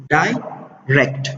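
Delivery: ډایریکټ slowly in two beats, dai and rekt, with a pause between; syllable by syllable. Shown as a demonstration. Teacher giving an example of two syllables, ډایریکټ said syllable by syllable. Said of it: "ټو واول ساوندز او ټو".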